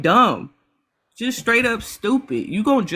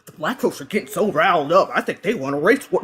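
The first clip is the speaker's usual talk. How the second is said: lightly voiced fearful commenter, a bit lower; mock the person panicking about a race war; a hint of a whiny scared voice; mocking quote; 4 semitones lower than usual. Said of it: "If you think".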